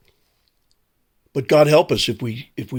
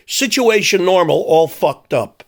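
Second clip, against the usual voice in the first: ordinary delivery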